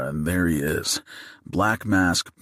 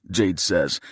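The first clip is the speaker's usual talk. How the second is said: ordinary delivery, nothing marked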